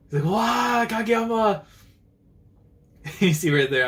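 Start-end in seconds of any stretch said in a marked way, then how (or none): 0.3-1.6 high, drawn-out call; hammed-up fan calling to a player; acted excited call. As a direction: call out the name in wonder. Acting out a character calling Kageyama.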